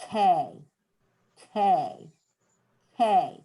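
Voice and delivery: K as a clean stop, tongue on the roof of the mouth; hard K. Shown as a demonstration. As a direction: click out a crisp K. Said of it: "the back of our tongue is hitting the roof".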